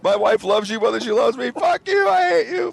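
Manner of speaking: high, laughing mock rage at the cat; half-sobbing fake fury, cracking into laughter; cursing the cat in hammed-up anguish; mock hatred. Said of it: "and I'm just like".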